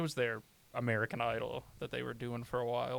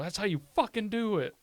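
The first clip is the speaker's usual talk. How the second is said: hyped up; 9 semitones higher, 5 dB louder than usual. Retold or imitated. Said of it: "And I go".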